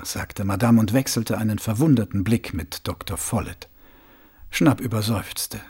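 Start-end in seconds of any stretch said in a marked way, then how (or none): none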